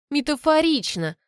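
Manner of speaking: high, mock-impressed remark; amused, arch comment; wry amusement. A narrator's reading. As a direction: toss it back with light irony. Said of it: "усмехнулась я".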